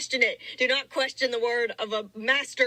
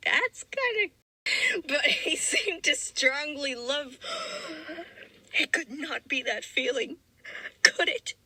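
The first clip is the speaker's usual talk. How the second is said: mock incredulous; 4 semitones higher than usual. Real, not acted. Mocking the romance reveal.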